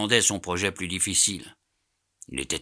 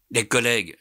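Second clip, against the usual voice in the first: higher, emphatic echo; exclaimed; 5 semitones higher, 4 dB louder than usual. A narrator's reading. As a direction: cry it out, worked up. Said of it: "s'écria Passepartout". (exclamation animée)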